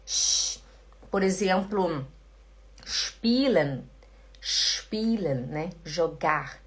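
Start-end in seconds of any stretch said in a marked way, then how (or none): 0.0-0.6 "sh" alone, no vowel; sh sound. Shown as a demonstration. Teacher showing how SP begins, a lone "sh". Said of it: "também faz som de". 2.8-5.5 "spielen" as shpielen; initial sh. Shown as a demonstration. Teacher giving an SP example, "spielen" as shpielen. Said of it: "P também faz som de".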